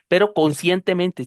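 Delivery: normal talking voice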